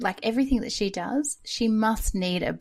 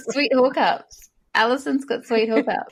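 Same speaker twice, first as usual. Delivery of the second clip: higher, louder playful tease; teasing; 6 semitones higher, 5 dB louder than usual. Real, not acted. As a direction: rib her about her connections. Teasing about famous friends.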